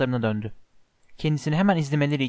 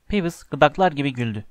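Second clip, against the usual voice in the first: ordinary delivery, nothing marked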